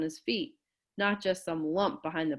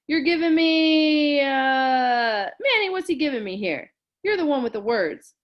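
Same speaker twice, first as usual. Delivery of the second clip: exasperated; 8 semitones higher, 8 dB louder than usual. Acted. Pitcher complaining to friends.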